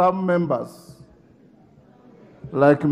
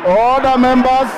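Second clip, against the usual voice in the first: raised, high, insistent calls over the noise; calling for order; 6 semitones higher than usual. Real, not acted. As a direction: keep hammering for order above the din. Speaker calling rowdy House to order.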